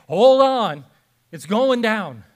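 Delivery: loud, high mock shout; alarmed intercom warning. Voice on the cabin intercom warning of a crash, loud alarm. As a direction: call out a cabin emergency at full voice.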